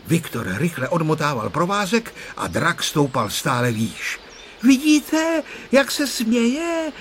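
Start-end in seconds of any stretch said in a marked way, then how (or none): 4.6-6.9 high, drawn-out excited call; high-pitched, thrilled exclamation; excited cry. A narrator's reading. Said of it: "vykřikl vzrušeně Honzík".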